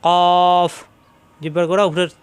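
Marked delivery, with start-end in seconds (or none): 0.0-0.7 emphatic কফ held full; heavy Arabic letter. Shown as a demonstration. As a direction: push কফ out heavy from the back of the throat. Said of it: "মোটা হবে".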